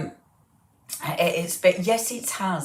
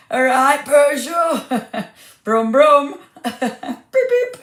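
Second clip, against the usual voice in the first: higher, slow brum brum and beep beep; playful car noises; 8 semitones higher, 8 dB louder, much slower than usual. Pretend. Host greeting viewer Peugeot with giggly car noises. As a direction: play at being a little car, giggling.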